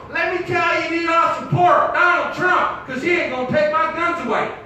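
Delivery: loud, high shouted parody; hammed-up rally shouting; mock gun supporter. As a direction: holler like a riled-up Trump fan.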